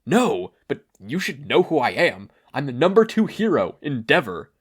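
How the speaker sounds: loud, puffed-up boast with a changed voice; proud hero. A narrator's reading. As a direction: announce your rank with pride. Endeavor boasting to Kathleen.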